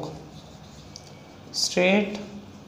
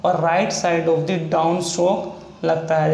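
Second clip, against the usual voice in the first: slow; much slower than usual. Shown as a demonstration. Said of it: "teacher repeats rule slowly".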